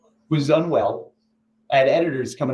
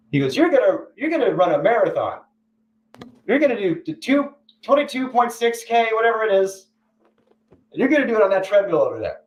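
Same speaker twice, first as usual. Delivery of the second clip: higher, chipper boss voice; cheery boss; 8 semitones higher than usual. Retold or imitated. Jimmy announcing the marathon challenge, higher and chipper. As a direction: lightly play an upbeat Jimmy.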